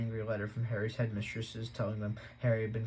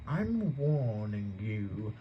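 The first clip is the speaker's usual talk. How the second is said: menacing warning; much slower than usual. Acted. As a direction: lean in and warn. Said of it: "putting his large purple face".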